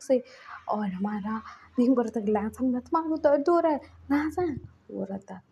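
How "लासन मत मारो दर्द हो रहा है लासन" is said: pained pleading. Acted. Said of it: "चीख रहा था, चिल्ला रहा था".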